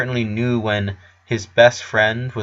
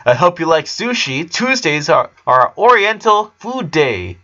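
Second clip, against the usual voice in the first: lightly raised, upbeat dad voice; cheerful dad; 6 semitones higher than usual. A narrator's reading. Howard telling the boys about sushi, lightly cheerful. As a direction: announce the menu happily.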